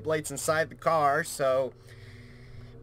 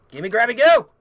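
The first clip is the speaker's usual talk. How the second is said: sudden loud, higher burst; urgent shout; 6 semitones higher, 13 dB louder than usual. Real, not acted. Player grabbing items in a hurry, urgent shout. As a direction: call it out, rising to a yell. Urging while grabbing in game.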